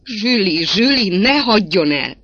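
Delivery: loud, high desperate outcry; pleading cry. A narrator's reading. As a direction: cry out and beg the lover to stay. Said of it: "Kiáltott fel könyörögve a férfi". (könyörgő felkiáltás)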